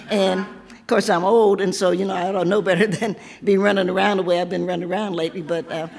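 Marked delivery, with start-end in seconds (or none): none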